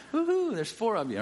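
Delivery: high, bright woohoo; preacher cheering the Chiefs fans, high and playful. Real, not acted. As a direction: whoop it up for the few fans.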